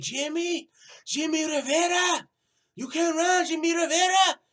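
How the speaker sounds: high, loud sing-song taunt, far from his own voice; a cartoonish high-pitched mock of the fighter calling him out. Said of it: "He said".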